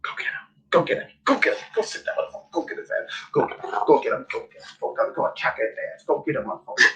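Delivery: hushed, egging the dog on; urging whisper. Real, not acted. Whispering to her dog, urging it to attack.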